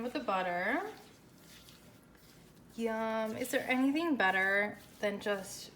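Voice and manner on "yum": ordinary talk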